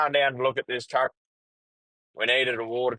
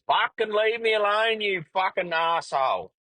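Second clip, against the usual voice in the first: high-pitched mock yell at the goat; half-acted furious scream at a goat; retold scream; 9 semitones higher than usual. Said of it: "And I'm screaming".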